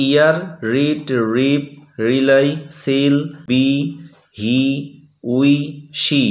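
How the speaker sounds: slow, long ee in রিড, সিল, বি. Shown as a demonstration. Teacher to students, sounding out the ee vowel slowly. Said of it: "ই ভাওয়েলের উচ্চারণ আমরা এর মতো পাচ্ছি".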